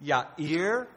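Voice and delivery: "Yair" said as yah-EER, a Hebrew Y and no J; Hebrew name Yair. Shown as a demonstration. Preacher naming Jairus's Hebrew name, careful pronunciation. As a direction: say "Yair" the Hebrew way, two beats, rising at the end. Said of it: "Jairus is the Greek way of saying".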